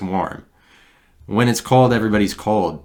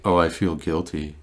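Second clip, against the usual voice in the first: mock guilt. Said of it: "I'm like".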